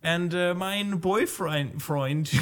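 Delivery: put-on German accent, halting; German girl. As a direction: play a German teenager greeting her pastor. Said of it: "let's just say she's German".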